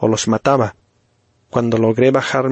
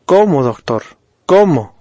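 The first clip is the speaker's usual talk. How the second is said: madre angustiada, el segundo «¿Cómo?» más alto y agudo; grito de madre; 4 semitones higher, 4 dB louder than usual. Acted. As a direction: grita desesperada al doctor. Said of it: "gritaba mi mamá". (la madre grita «¿Cómo?» al doctor, angustiada)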